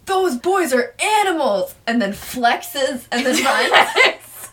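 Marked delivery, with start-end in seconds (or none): 0.1-1.7 higher, drawn-out indignant exclamation; mock outraged cry. Host imitating teacher complaining to Gustavo, mock outrage. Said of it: "looks at Gustavo and says".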